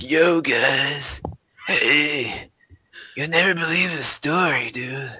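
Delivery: high, loud put-on stoner drawl. Imitating Fluffy Talks telling a news story, stoner voice. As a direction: imitate a stoner hailing his viewers. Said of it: "a stoner fashion".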